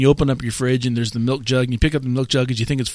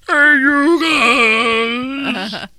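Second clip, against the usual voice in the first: slow, high Goonies Sloth shout; drawn-out Goonies Sloth yell; 11 semitones higher, 4 dB louder, much slower than usual. Retold or imitated. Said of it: "Goonie sloth thrall".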